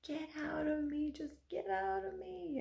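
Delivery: high, thin, hushed mock scream; retold scream. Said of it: "a woman in the room who was screaming".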